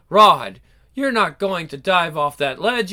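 put-on girl warns boyfriend off the ledge, alarmed; alarmed call. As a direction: stop him before he jumps. Warning him off the dive.

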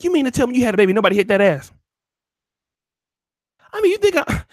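put-on puffed-up boyfriend snapping back; angry Joseph. Joseph confronting Percy about Mary, mock angry. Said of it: "he get mad".

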